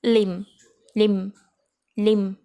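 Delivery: लिम said loud, closing on the m with no b; limb with silent b. Shown as a demonstration. Teacher drilling the word limb, loud and clear. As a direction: say लिम crisply, no b sound. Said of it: "silent करेंगे बोलते वक्त".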